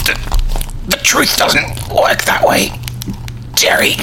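choked, voiceless squeezing out of words; being strangled. Acted. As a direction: force words through a throttled throat.

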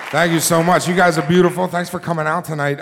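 loud, high announcer welcome; host welcome. Acted. Host welcoming the Moth audience, loud and high. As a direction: greet the crowd loud and bright.

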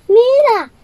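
high, loud urgent command; urgent fairy. Acted. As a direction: yell at her to throw it now. Fairy urges Cinderella to throw watch.

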